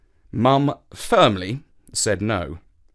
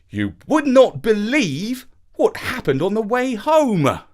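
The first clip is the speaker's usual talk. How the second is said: angry; 8 semitones higher, 4 dB louder than usual. A narrator's reading. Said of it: "Dad said angrily".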